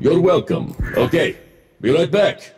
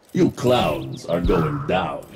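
slow, much deeper taunting shout; 4 dB quieter, much slower than usual. Acted. Mech warrior taunting rookie enemies, slow deep menace. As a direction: talk down to the other team, slow and heavy. Robot taunting the enemy team.

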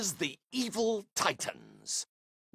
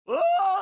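startled cry; 12 semitones higher, 6 dB louder than usual. Acted. Startled cry as someone enters.

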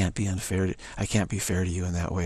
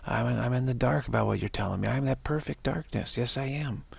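normal talking voice

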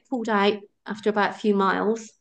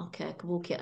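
much softer, resigned mutter; weary resignation; 4 semitones lower, 12 dB quieter, faster than usual. Retold or imitated. Exhausted runner agreeing to walk in, much softer and resigned. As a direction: give in quietly, worn out. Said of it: "I'm like".

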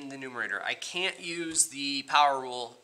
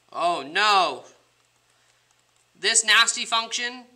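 higher, louder fake alarm; mock panic; 8 semitones higher, 7 dB louder, slower than usual. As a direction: play a panicked student. Acting panicked student.

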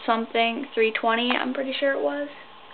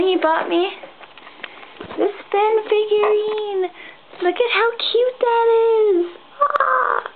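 excited squeal; 11 semitones higher, slower than usual. Real, not acted. Showing boyfriend's gift figurine.

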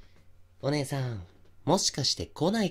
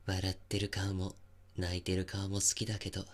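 soft creepy; 3 semitones lower, 7 dB quieter than usual. Acted. Over sleeping woman.

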